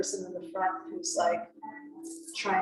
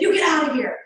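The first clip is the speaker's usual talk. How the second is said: louder, higher mock shout to drive someone off; a sharp, startled retold yell; 7 semitones higher, 8 dB louder than usual. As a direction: fling it out like warding off an attacker. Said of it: "so I said".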